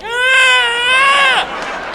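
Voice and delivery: high, tight choked scream; strangled inaudible cry. Retold or imitated. Drowning skier's choked high cry for help. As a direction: squeeze out a strangled yelp. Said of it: "It came out like".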